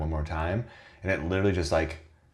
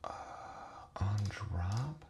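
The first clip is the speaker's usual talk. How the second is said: whispering; 3 semitones higher, 9 dB quieter, slower than usual. Real, not acted. Muttering to himself while coding.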